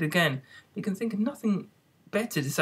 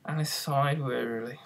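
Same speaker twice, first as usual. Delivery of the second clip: usual speaking voice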